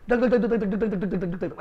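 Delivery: rapid, even "dhak-dhak" jackhammer noise; imitated machine chugging. Teacher mimicking a bulldozer at a dig for students, imitated machine chugging. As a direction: imitate a pounding digging machine.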